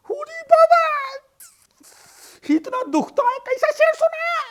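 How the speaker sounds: very high, loud Bengali wail. Acted. Beaten Bengali neighbour wails at Raju in a Bengali accent. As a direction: whine in pain, in a Bengali accent. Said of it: "beaten dada wails".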